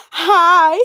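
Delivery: loud, high-pitched doll greeting; Cat Valentine. Acted. Doll saying hello, high Cat Valentine voice. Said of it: "uses the Cat Valentine's Day voice".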